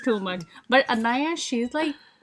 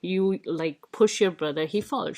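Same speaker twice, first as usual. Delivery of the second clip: imitating stern grandpa; 6 semitones lower than usual. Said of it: "Grandpa was telling her".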